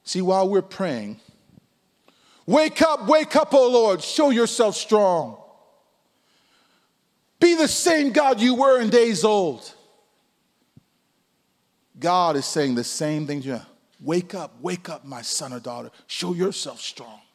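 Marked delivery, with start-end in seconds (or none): 2.5-5.4 loud, high, pleading prayer cry; crying out to God. Acted. Pastor praying aloud to God, loud pleading cry. 7.4-9.7 loud, high, pleading prayer cry; crying out to God. Acted. Pastor praying aloud to God, loud pleading cry. 14.0-17.2 soft, calm, firm call; God's quiet reply. Acted. God answering the believer, softly and calmly.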